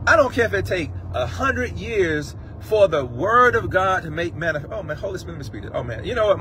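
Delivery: ordinary talk, nothing marked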